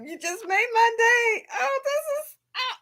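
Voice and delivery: gushing thanks, almost crying; teary thanks. Real, not acted. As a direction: thank her through happy tears. Thanking the sender.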